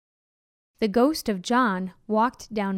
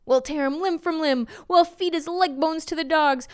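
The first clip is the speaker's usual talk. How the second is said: higher-pitched, worked-up threats; angry mob threat; 5 semitones higher than usual. A narrator's reading. Angry townspeople surround the ghost, heated threats. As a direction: threaten him as a riled townsperson.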